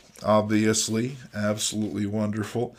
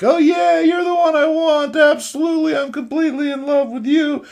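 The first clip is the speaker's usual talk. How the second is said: very high, very loud mock swoon; hammed-up falsetto infatuation; gushing girl; 10 dB louder than usual. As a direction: squeal it like a lovestruck comic girl. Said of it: "she's like".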